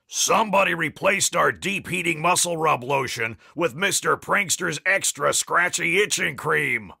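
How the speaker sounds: coach's mild theatrical holler; lightly acted shouting coach; shouted complaint. A narrator's reading. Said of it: "shouted the coach".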